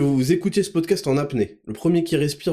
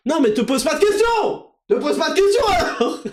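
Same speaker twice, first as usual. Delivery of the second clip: mock outburst; 10 semitones higher than usual. Mock outrage at listeners.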